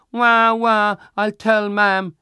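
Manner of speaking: put-on loud, high child's wail; high-pitched, drawn-out mock bawling; crying. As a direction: bawl it like a tattling little boy. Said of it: "I push him and he cries".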